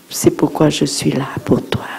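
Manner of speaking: whispered tender reassurance. Shown as a demonstration. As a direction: breathe it softly like a secret. Tender words to mother. (chuchoté, tendre)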